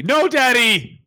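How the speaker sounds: high, drawn-out mock protest; hammed-up high, slow refusal; protesting son. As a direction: refuse Daddy like an embarrassed child. Acted son refusing father's kiss.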